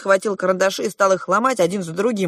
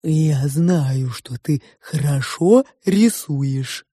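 slow, honeyed sow; tender-voiced pig; 4 dB louder, much slower than usual. A narrator's reading. The pig praises the girl's drawing, tenderly. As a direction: speak fondly, drawing out the vowels. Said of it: "ласковым голосом сказала свинья".

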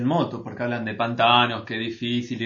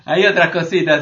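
loud, higher playful echo; 4 semitones higher, 8 dB louder than usual. Real, not acted. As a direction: sing it back playfully. Echoes host's laughing question.

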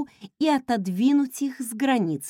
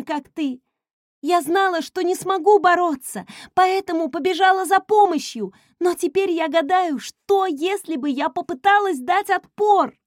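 voice breaking; 7 semitones higher, 5 dB louder than usual. Acted. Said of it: "Её голос надломился, когда она всхлипнула".

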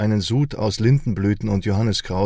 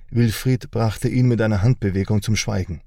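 usual speaking voice

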